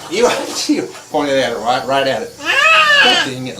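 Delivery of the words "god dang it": exasperated. Real, not acted. Camera pointed wrong.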